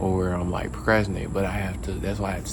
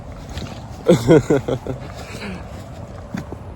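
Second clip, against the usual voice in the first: breathy laugh; 6 semitones higher than usual. Real, not acted. Laughs before starting video.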